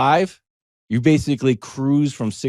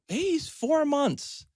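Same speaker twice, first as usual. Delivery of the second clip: much higher and slow, doting parent; mock proud parent; 6 dB quieter, slower than usual. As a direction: coo it like a proud new parent.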